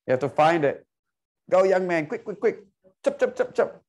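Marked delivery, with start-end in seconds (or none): 1.5-3.8 higher, rapid-fire hurrying; urging on. Real, not acted. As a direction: hurry the student along in quick bursts. Hurrying a student in the search.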